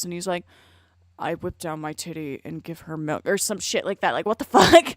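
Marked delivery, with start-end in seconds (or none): 1.2-3.3 lightly put-on low British narrator; a hint of mock British narration, pitched down; deep fake British voice. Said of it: "narrating in this deep, fake British voice".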